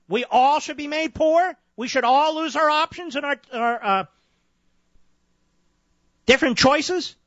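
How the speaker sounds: high-pitched disbelieving rhetorical questions; incredulous indignation. Real, not acted. Host attacks the logic of making all poor, incredulous. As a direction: pitch it high, challenging an absurd argument.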